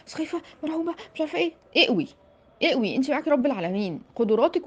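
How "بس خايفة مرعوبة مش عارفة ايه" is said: high, soft mock whimper; a hint of a whiny scared girl; mocking whiny fear. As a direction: mimic a scared candidate, teasing her. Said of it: "مش كل حاجة".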